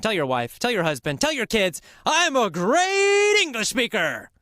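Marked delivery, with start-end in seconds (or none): 2.1-4.3 jubilant announcement. Shown as a demonstration. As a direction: shout it to the whole household. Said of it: "jump around your house".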